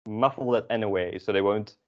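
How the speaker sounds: ordinary talk, nothing marked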